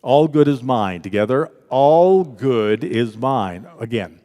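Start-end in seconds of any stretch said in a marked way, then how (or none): 1.7-3.7 very slow, for all to join in; drawn-out, measured affirmation; slow group affirmation. Real, not acted. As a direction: lead a congregation chanting in unison. Leads congregation in slow unison.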